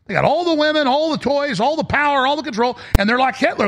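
pitched high, building list of grievances; mounting rant. Real, not acted. Ranting host building on all the spoils.

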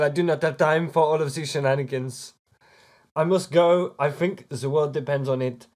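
put-on foreign accent, grandly insistent; formal, pressing in-character voice; urgent accented adventurer. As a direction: announce a pressing quest in a theatrical accent.

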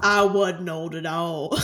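slow, relishing echo; quoting the movie. Retold or imitated. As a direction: savour each word. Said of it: "entirely quotable scene".

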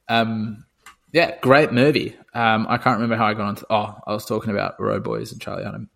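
normal talking voice

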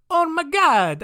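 put-on high-pitched, botched Portuguese accent; hammed-up mock Portuguese prince; bad Portuguese accent. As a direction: exclaim in a badly faked Portuguese accent. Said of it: "I don't know what accent Portuguese is".

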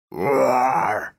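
rough throaty screech-roar; raspy owlbear roar. Shown as a demonstration. DM voicing the owlbear, raspy roar. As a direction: croak it like a sick owl. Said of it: "You sound like an owl with throat cancer".

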